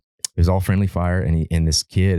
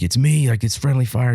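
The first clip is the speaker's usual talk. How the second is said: retold yell; 8 semitones higher than usual. Soldier identifying himself to his own platoon, retold yell. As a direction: imitate a soldier calling out, voice raised high. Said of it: "He's yelling".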